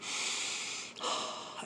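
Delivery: long, slow audible exhale; deep breathing. Shown as a demonstration. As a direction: breathe on through the yelling. Demonstrates his breathing amid the yelling.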